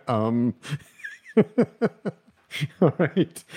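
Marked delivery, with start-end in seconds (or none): 1.3-2.1 high giggling laugh; laughter. Real, not acted. Laughing at own listener-teasing joke, high giggle. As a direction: crack up in high bursts.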